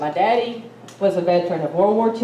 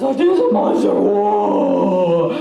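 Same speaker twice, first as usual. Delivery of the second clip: mock bawling; 4 dB louder, much slower than usual. Teenager tells sick mama about the monster, mock bawling. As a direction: imitate a bawling kid in a panic. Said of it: "And I was bawling".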